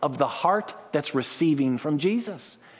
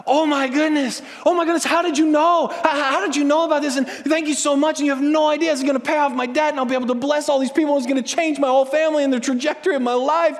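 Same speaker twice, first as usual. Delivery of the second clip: much higher, louder, breathless joy; overjoyed; 9 semitones higher, 4 dB louder than usual. Retold or imitated. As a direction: gush with wild thanks. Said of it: "You're gonna freak out".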